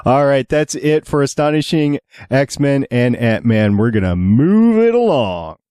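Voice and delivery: ordinary talk, nothing marked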